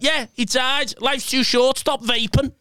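high-pitched imitation; retold stranger's voice. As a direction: mimic a stranger handing out life advice. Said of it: "he went".

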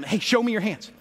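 normal talking voice

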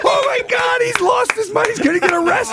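high, shouted disbelief; imitating party guests freaking out; mock shocked friends. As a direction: squeal like a stunned friend watching TV. Friends reacting at Oscar party.

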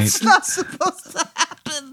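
high-pitched, squeaky laughing objection; laughing protest. Real, not acted. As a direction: protest through helpless laughter. Player laughs at absurd ending.

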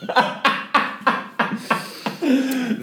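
a high burst of laughter. Real, not acted. Recalling the egg swap to a friend, laughing high. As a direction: laugh at the memory.

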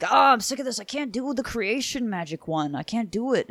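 a lightly hammed-up fed-up student; mock frustrated pupil. Host voicing the fed-up pupil to her teacher, mock whine.

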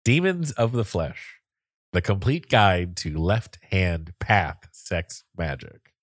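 slow, deliberate title reading. Shown as a demonstration. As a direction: spell out the title phrase by phrase. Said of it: "Do you want to say it slower".

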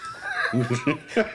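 high shouted laugh; drunken whooping laugh. Acted. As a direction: let out a wild tipsy whoop.

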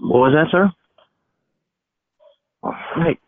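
usual speaking voice

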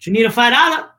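put-on high Puerto Rican dad accent, questions lilting up; dad's Spanish accent. Son mimics his dad offering money, high Spanish accent. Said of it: "I don't know why he has an accent".

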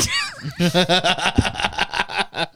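shouting laugh; real laugh. Real, not acted. Laughing at own story.

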